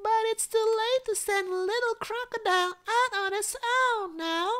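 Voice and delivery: put-on very high, protesting little girl; child crying out. A narrator's reading. Cecily objects to sending crocodile out alone, high-pitched cry. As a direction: plead in a squeaky child's voice. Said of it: "cried Cecily".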